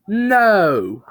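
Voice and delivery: loud, high, gleeful no; happy yell. Acted. Bob cuts off the truck with a gleeful yell. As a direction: shout it with delight. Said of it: "Bob yelled happily".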